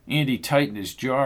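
usual speaking voice

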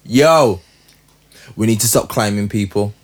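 usual speaking voice

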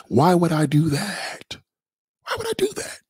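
incredulous, climbing into a squeak. Real, not acted. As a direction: question it in a high, disbelieving voice. Refusing a viewer's call-in request.

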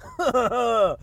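short hearty laugh. Real, not acted. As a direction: open with a real chuckle. Laugh opening the podcast.

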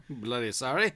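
soft, low British sorry; mock British. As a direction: mutter it like a Brit. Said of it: "British person".

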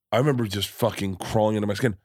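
normal talking voice

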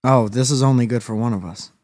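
ordinary talk, nothing marked

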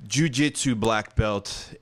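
slow, loud ring announcer call. Shown as a demonstration. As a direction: stretch it out like a fight intro. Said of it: "Ladies and gentlemen".

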